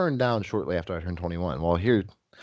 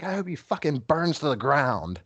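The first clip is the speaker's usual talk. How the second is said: higher-pitched retold ill wish; lightly acted high indignation; imitated angry curse; 7 semitones higher than usual. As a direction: act out a thrown-out drunk's parting curse. Retold furious parting curse.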